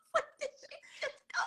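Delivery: high laughing, breaking into whisper; laughing disbelief. Real, not acted.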